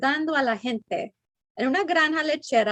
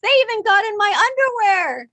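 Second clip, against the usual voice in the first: imitating photographer; 9 semitones higher, 6 dB louder than usual. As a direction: ham up the complaining photographer. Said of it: "He said".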